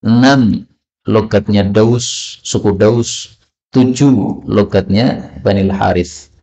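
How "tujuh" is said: usual speaking voice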